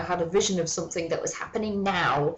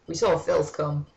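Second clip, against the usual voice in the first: ordinary talk, nothing marked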